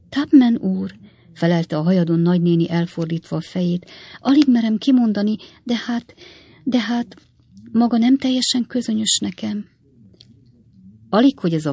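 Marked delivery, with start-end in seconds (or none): 0.1-0.9 high, louder flustered address; coy lady. A narrator's reading. Shy aunt answers her suitor. 4.2-9.6 high, hesitant lady's voice; coy confession. A narrator's reading. Shy aunt confesses her love.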